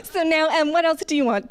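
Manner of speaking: high, gushing order-taking; desperate-to-please mock waitress; over-eager server.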